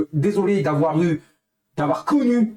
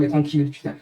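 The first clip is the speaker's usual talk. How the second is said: ordinary talk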